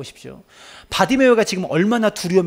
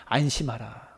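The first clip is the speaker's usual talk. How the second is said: soft and much lower; tender; 5 semitones lower, 7 dB quieter, slower than usual. Acted. Jesus's first word to the blind man, soft. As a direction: hush him with comfort. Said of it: "첫 번째 말씀이".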